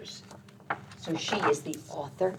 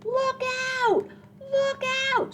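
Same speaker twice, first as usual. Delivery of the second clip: tiny high squeaky rabbit voice; rabbit warning. Acted. Rabbit calls to the speeding sleigh, high and squeaky.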